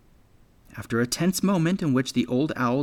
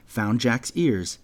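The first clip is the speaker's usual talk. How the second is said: ordinary delivery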